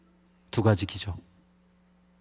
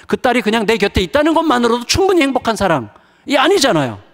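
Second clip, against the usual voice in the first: loud, high, driving sermon delivery; forceful preaching; 9 semitones higher, 7 dB louder than usual. Acted. Pastor preaching to the congregation, loud and forceful.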